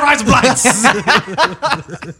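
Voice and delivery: high, slow Aussie yell with laughs; shouted Australian accent. Shown as a demonstration. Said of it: "why are you just shouting?".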